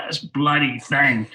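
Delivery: half-hearted Cockney grumble, drawn out slowly; a hint of Cockney, slow; attempted Cockney accent. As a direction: imitate a Cockney grouch lightly. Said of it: "do a Cockney accent".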